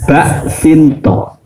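bak sinto drawn out slowly, syllable by syllable; correct form spelled out. Shown as a demonstration. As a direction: separate bak, sin, to in bak sinto. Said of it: "sing ono iku".

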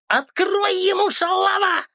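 put-on old woman howls at the clerk; old woman yelling. A narrator's reading. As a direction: screech the insult from the queue. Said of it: "завопила старуха".

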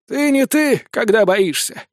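high-pitched, mysterious teasing; enigmatic drawl. A narrator's reading. Woman teases the frightened wizard, enigmatic drawl. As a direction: draw it out knowingly, starting high. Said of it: "загадочно протянула Канистровна".

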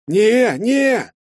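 high, loud panicked yelp; panicked shout. Acted. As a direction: cry out in alarm to stop him. Hero panics about the fireball.

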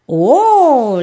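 high, loud playful donkey bray. Acted. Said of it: "le hace el burrito". (rebuzno de burrito)

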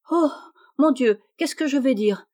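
stumble, then exclaim in a mild panic. A narrator's reading. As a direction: at a loss mid-story. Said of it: "s'écria Sally".

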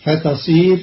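ordinary talk, nothing marked

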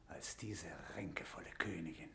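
leise, verächtlich geflüstert; verächtliches Flüstern. Acted. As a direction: voller Abscheu flüstern. Paulet zischt über Maria. (Paulet flüstert verächtlich über die Königin)

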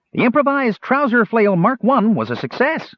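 high, loud proud gnome voice; boastful gnome. A narrator's reading. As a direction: puff up and boast.

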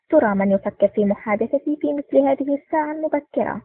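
plain reading voice

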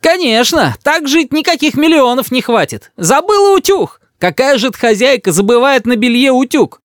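very high grandmother's outrage; shrieked scolding. A narrator's reading. Grandmother blames mother for the iron, outraged cry. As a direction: scold away at a high pitch. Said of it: "кричит бабушка".

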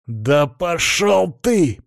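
slow, drawn-out snarl; growling. A narrator's reading. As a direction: spit it at the devourer. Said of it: "прорычал Антон в ответ".

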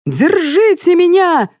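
high, panicked yell for help; screaming. A narrator's reading. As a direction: scream to be caught. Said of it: "завопил полковник". (отчаянный вопль)

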